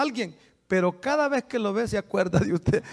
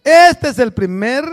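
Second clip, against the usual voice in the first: slow, solemn, louder; scripture reading; 3 semitones higher, 5 dB louder than usual. Acted. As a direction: proclaim the commandment solemnly. (proclamando la Escritura con solemnidad)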